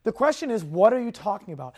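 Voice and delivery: usual speaking voice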